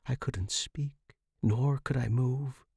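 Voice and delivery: ordinary talk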